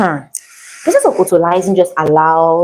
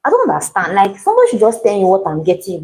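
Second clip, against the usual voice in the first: normal talking voice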